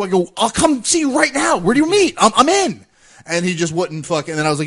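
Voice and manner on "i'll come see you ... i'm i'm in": challenging. Retold or imitated. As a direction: push him, raise the voice. Retold challenge to meet up.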